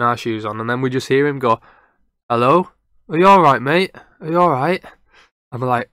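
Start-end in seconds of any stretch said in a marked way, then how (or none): 2.3-4.8 higher, lightly raised retold call-out. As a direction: imitate a mate checking on someone out there. Friend calls out to unseen stranger.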